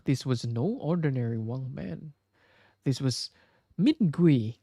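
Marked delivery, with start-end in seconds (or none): none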